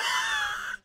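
high shrieking laugh of fright; startled laugh-scream. Real, not acted. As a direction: yelp and laugh at once. Startled by the game.

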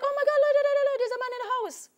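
high, loud panicked cry of "Lord, Lord"; a scared kid shouting there's a man in the house; panicked child. Retold or imitated. Child panics seeing strange man.

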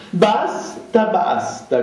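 sharp cry of basta; shouting. A narrator's reading. Zirkov shouting to silence the table. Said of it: "gridava Zirkov".

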